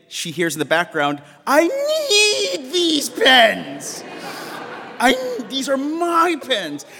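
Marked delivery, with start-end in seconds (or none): 1.5-6.9 very high, slow, strange creature voice, clinging to the pens; Gollum voice. Retold or imitated. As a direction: stretch each word out high and greedy like a cave creature. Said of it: "like Gollum or something".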